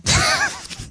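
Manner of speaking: squeaky high laugh; high giggle. Real, not acted. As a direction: burst into giggles. Laughs at name mangling.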